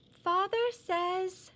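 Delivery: put-on high, slow little girl. A narrator's reading. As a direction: voice her as a young girl. Little Miss Revere voiced.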